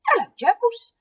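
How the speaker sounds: put-on high, squeaky little child voice; two-year-old boy. Eddie greeting gerbils in their cage, toddler voice. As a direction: chirp it like a toddler greeting pets. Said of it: "he was sitting under the table".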